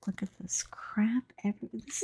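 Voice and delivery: unvoiced whisper to herself; whispered aside. Real, not acted. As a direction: hiss it under your breath at the mess. Muttering aside at her painting.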